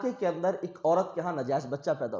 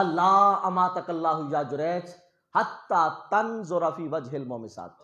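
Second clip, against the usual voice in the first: slow solemn curse; slower than usual. Retold or imitated. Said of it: "بد دعا دے دی".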